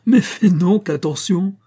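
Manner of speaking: high, loud, slow mocking cry; laughing shout. Giant mocks Hercules for shaking the sky, shouting. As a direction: bellow it at him half laughing. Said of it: "cria Atlas avec un grand éclat de rire".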